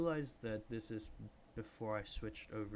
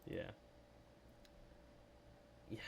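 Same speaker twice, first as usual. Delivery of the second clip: normal talking voice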